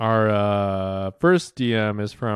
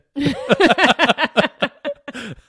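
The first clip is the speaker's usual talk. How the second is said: high, loud real burst of laughter; cracking up for real at the joke; 7 dB louder than usual. Real, not acted. Host cracking up at a listener's funny message, real laughter.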